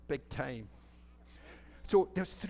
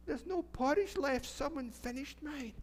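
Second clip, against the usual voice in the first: little bear; 9 semitones higher than usual. Acted. Littlest bear voiced in story.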